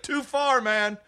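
high-pitched mock yell; heckler's protest. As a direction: call out like an outraged heckler. Retold heckler objecting at show.

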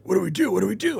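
high-pitched fretful repeat, imitating; mock panic. Crew at a loss over jammed gun, mock panic.

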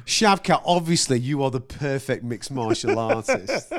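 fanboy impression. Mocking his co-host interviewing the fighter, fanboy impression. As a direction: tease with a hammed-up fanboy interview opener. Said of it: "he gives me shit for fanboying".

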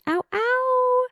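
high party whoop; excited squeal. Real, not acted. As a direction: squeal with holiday glee. Excited holiday cheer.

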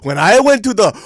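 high, loud mocking caricature; mocking mom. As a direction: ham up the mom's bragging. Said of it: "And she goes".

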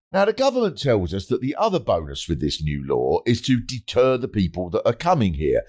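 ordinary talk

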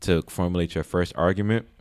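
ordinary delivery

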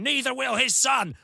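high, pompous mock declaration; mock defiant politician. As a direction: ham up the patriotic defiance. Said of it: "he goes".

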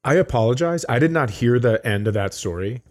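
usual speaking voice

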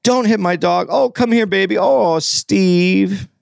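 high-pitched pleading cry to a pet; high, drawn-out mock distress; Adriana. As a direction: act out a woman protecting then comforting her dog. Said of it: "she goes".

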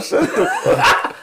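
high squeaky laughing protest; laughing disbelief. Real, not acted. Laughing disbelief at friend's pick.

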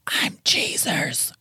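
very slow, drawn-out ghost whisper; imitated EVP whisper; ghostly whisper. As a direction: stretch it out in a spooky whisper. Voices option as the ghost.

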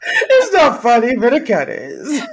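guilty giggle breaking through; half-laughing it's not funny. Real, not acted. Laughing at Michael's sad scene.

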